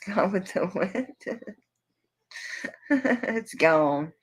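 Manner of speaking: talking through a giggle; laughing. Real, not acted. Joking to viewers about a misplaced ring sizer, laughing. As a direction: let a chuckle break into the words. Laughing at her own joke.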